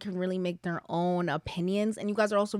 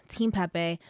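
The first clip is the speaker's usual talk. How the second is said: ordinary talk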